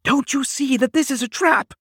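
high, loud frantic cry; frantic warning. A narrator's reading. Wife warning husband of trap, frantic. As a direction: scream the warning at him. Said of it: "in a terrible voice".

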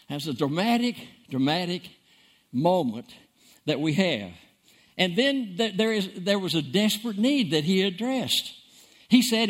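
usual speaking voice

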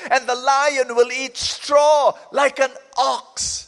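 loud slow proclamation; shouting. Acted. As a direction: boom it out slowly. Preacher shouts the scripture climax.

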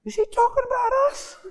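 very high, incredulous question; suspicious onlooker. Acted. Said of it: "then one guy goes".

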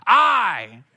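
top-of-the-voice shout, pitched high; screamed out. Retold or imitated. Newly baptized kid casting a first vote, screamed out. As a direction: yell it as loud as you can. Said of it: "at the top of my voice, I screamed out".

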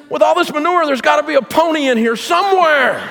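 high and bright with a laughing shout; delighted optimist. Retold or imitated. Little optimist boy tossing manure, laughing and gleeful. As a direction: beam out the hopeful punchline. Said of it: "he said".

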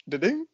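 high two-note messenger chime; imitated notification tone. Podcaster recalling the old messenger tone for co-host, imitated chime. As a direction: sing out a little alert ding. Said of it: "we should get the tone up".

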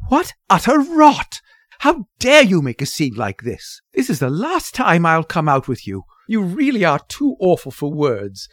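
loud, high indignant tirade; outraged daughter at full voice; furious. A narrator's reading. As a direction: berate your mother, punching the key words. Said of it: "she said furiously".

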